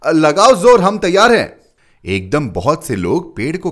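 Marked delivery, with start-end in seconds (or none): 0.1-1.6 loud, high, ready to go; rally everyone loudly; eager shout. A narrator's reading. Crowd eager to lift tree.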